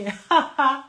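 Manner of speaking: loud, high laugh; real amused laugh. Real, not acted. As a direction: burst out laughing at yourself. Laughs at her own grudge story.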